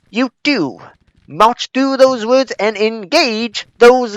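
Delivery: loud, high Southern officer giving formal orders; pompous Southern colonel barking battle orders; Southern accent. Acted. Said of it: "I guess I shouldn't have a Southern accent".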